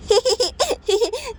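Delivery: high little snicker; mischievous child giggle. Acted. Naughty son giggling before waking his father. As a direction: giggle like a naughty kid about to pull a prank. Kid plots to wake father.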